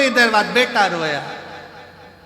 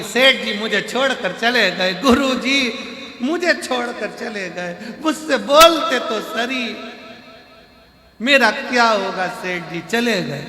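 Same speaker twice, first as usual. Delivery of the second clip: raised, stretched mock crying; loud mock wailing; 5 semitones higher, 3 dB louder than usual. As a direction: wail it out like a hammed-up grieving wife. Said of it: "पत्नी रोई खूब चिल्ला चिल्ला कर".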